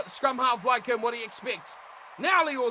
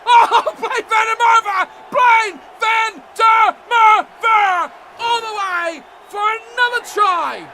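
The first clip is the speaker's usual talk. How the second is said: high, loud, drawn-out name call; ecstatic cheer; 8 semitones higher, 12 dB louder, much slower than usual. Real, not acted. Commentator celebrating Blaine Van der Merwe's try, ecstatic drawn-out cheer. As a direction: stretch the name out in triumph.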